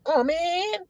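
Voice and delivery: high, drawn-out mock whine; mock hurt feelings; whining feelings. Acting out complaining feelings.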